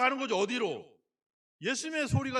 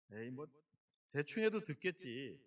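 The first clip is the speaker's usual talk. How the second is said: lazy shrug; 8 semitones lower, 8 dB quieter than usual. Pretend. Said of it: "그러지 마시고".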